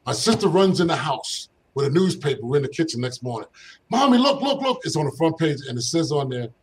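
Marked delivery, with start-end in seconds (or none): 3.9-4.8 high, louder excited call. Retold or imitated. Kid showing mom the newspaper, excited high call. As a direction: urgently get Mom's attention, pitched way up. Excited child shows mother the paper.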